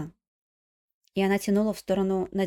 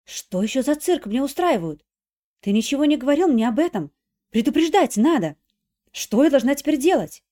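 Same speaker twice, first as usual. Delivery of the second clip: loud, higher, exasperated scolding; irritated outburst; 5 semitones higher, 8 dB louder than usual. Acted. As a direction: snap at him in irritation. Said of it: "очень раздражённым тоном прошипела". (раздражённый крик)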